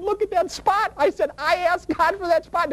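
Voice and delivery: high, slow, awed emphasis; telling the counselor about the spot; thrilled telling. Retold or imitated. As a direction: show off the miracle, amazed.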